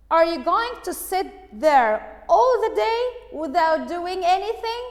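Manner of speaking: high, loud scolding wife; sharp, indignant shout at a lazy husband. Acted. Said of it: "very loud, loud and sharp voice".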